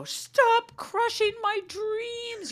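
put-on very high, slow whiny child voice; dramatic child. Kid refused dessert, high slow melodramatic whine. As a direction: wail it like a tragic kid. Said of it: "they go".

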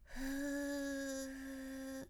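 soft long blow with a little voice; voiced soft exhale. Shown as a demonstration. Teacher shows students a breath with light voice, soft long exhale. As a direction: breathe out, humming softly under it. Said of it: "ちょっと声を出しながら息を吐いて".

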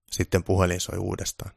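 ordinary delivery

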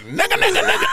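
high, yappy dog voice; words as barking. Acted. Comedian mimicking a chihuahua barking at him, high and yappy. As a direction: yap the word out in quick high bursts. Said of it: "he was barking. He was like".

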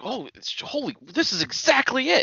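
high-pitched, breathless-with-awe freak-out imitation; mock excited outburst, high voice. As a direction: act out someone freaking out with delight, pitch leaping up. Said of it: "he starts freaking out".

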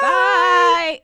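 shouted high goodbye; loud goodbye. Real, not acted. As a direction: belt out the bye. Unison goodbye.

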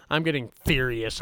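low-key warning of rising anger; contained fury. Acted. Host warning viewers of his temper, contained fury. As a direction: hold the fury back, keep it restrained. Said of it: "I'm getting fucking furious".

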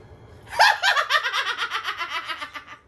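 high loud cackling laugh; loud cackle. Real, not acted. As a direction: burst into a cackle. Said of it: "I'm cackling".

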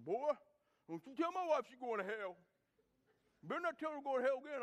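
loud, fast menacing warning; hammed-up furious husband; angry threat. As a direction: voice the husband warning the young preacher.

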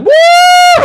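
very high, loud cheer; exultant whoop. Acted. Teller acts out fireworks in his mind, whooping. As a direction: let out a big celebratory woo. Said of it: "in my mind".

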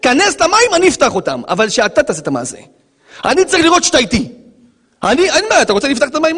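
God pushing Moses to act. Acted. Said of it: "אומר הקדוש ברוך הוא למשה רבנו".

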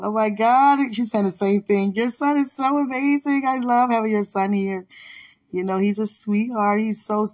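high, gushing praise; a teacher raving to a mom about her son. Retold or imitated. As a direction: bubble over with praise for the son.

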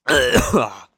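loud cough; coughing up water. Real, not acted. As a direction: clear the choke with a cough. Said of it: "went down the wrong way".

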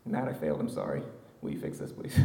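low, soft, meek voice, whispering the plea; mock-pitiful worker to boss Matt; sheepish apology. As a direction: play the chastened worker begging the boss. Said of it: "on construction sites with Matt".

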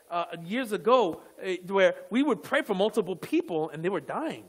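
ordinary delivery

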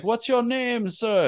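high, sing-song tree hello; lightly hammed tree welcome; talking tree greeting. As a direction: greet the lost visitors brightly.

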